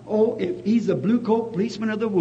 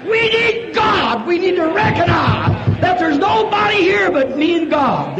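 high, loud, slow preaching shout; full-force preaching; 10 semitones higher, 7 dB louder, slower than usual. Acted. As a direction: hammer it home to the congregation.

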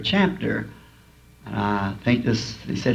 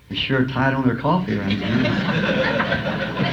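amused thought; much slower than usual. Retold or imitated. Retold inner thought, laughing.

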